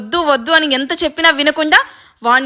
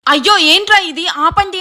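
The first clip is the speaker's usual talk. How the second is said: alarmed cry; 6 semitones higher than usual. A narrator's reading. Grandmother finding grandsons fighting, alarmed cry. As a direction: cry out to stop them. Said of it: "అంటూ రాజుని పట్టుకొని".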